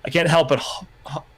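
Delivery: ordinary delivery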